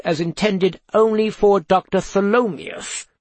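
arch interrogator voice. Acted. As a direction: probe with arch politeness. Pewter interrogating.

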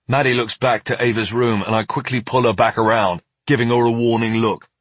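ordinary delivery, nothing marked

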